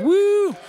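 high, held whoop; cheering woo. Real, not acted. Host cheering on the Inspired Word Cafe, high whoop. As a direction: let out a high celebratory woo.

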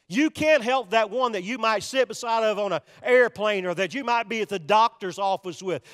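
ordinary talk

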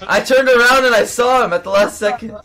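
sudden loud shout, then laughing; startled shout. Real, not acted. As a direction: jump at the scare and laugh. Sudden scare in game.

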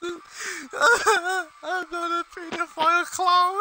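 high, slow words squeezed through laughter; helpless laughter. Real, not acted. Denying he's a creepy clown to friends, laughing helplessly. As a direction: protest while cracking up. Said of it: "don't even know what's so funny".

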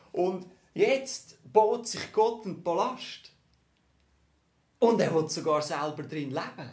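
emphatic climax. Real, not acted. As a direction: land the big reveal with full force. Climax of the house picture.

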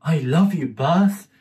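put-on high, sweet little boy. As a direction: say it like a small child, high up. Child Dimitri voiced in story.

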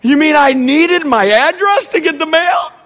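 high, loud disbelief; imitated daughter aghast at the obvious; mock incredulous wail. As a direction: blurt it out in exaggerated dismay. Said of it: "this fleshly moment where it's like".

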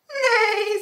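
high whinnying neigh; whinny like a horse. Acted. Horse's call in story.

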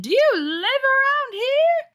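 very high, slow, shrill question; shrill mom voice. Acted. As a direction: grill her like a suspicious mother. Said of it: "what the mom sounds like".

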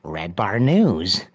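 slow, low creepy caller voice, lightly put on. Prank caller on the hotel phone introducing himself, slow low creepy voice. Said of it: "Like a r-, creepy voice".

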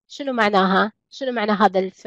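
ordinary talk

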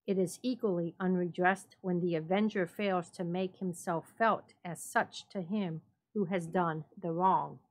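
ordinary delivery, nothing marked

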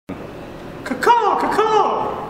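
high screeching crow caw; shrill crow caw. Acted. Crow calls in skit.

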